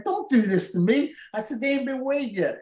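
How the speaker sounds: high-pitched retold plea; pleading protest. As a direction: object in alarm, voice climbing. Retold plea not to celebrate early.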